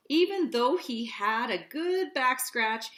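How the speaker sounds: ordinary delivery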